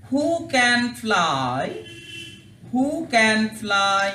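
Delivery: who can fly very slowly, who stressed; slow English drill. Shown as a demonstration. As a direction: spell out the phrase slowly for children. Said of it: "কাছ থেকে তোমাদের দেখায়". (ধীরে ধীরে ইংরেজি উচ্চারণ)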